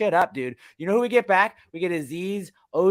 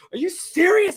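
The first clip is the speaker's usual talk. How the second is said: very high, loud disbelief; squawked, loud protest at the refs; incredulous outburst; 7 dB louder than usual. Retold or imitated. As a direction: shoot way up in pitch, outraged at the call. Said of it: "not cursing, didn't say".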